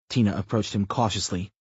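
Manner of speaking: ordinary delivery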